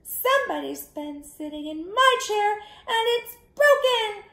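very high, upset little bear; wee little baby bear voice. A narrator's reading. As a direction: complain loudly in a tiny high voice. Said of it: "in his wee little voice said".

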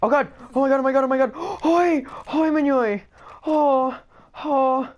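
high, loud ecstatic shrieks; shrieking jackpot winner. Real, not acted. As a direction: scream like you just won big. Shrieking after a win.

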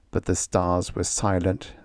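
usual speaking voice

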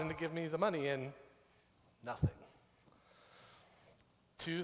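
usual speaking voice

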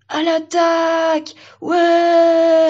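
high, drawn-out charge shout; loud crowd war whoop; battle cry. Acted. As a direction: lead a cheering charge. Trees charge cheering.

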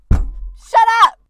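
loud, high-pitched snap; sudden shout. Real, not acted. Snaps during botched take.

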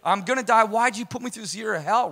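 worked-up sarcastic venting, acting out your own panic; retold freak-out. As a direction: re-enact freaking out, voice climbing and loud. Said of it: "I'm, like, freaking out".

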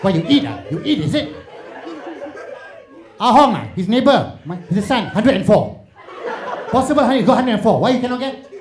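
high, loud angry mother nagging. Retold or imitated.

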